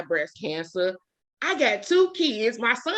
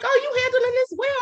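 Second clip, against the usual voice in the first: nurse voice. Retold or imitated. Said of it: "They're like".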